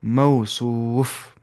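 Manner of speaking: मौसूफ drawn out slowly, each syllable clear; slow demonstration. Shown as a demonstration. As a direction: spell out मौसूफ slowly for the students. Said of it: "क्या बन जाएगा ये?". (मौसूफ धीरे-धीरे, साफ़ अक्षरों में)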